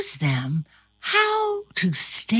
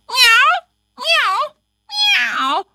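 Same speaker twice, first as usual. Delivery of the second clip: cat meows; 5 dB louder, slower than usual. A narrator's reading. Cat meowing in a chase.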